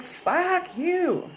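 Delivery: high, sharp mock outburst; retold angry outburst. Retelling a fight with her ex, high mock snap. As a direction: fling it back at him, half-acted. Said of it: "I was like".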